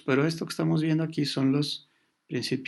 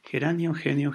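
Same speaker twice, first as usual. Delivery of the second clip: ordinary talk, nothing marked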